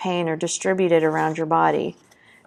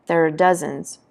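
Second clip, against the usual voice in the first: usual speaking voice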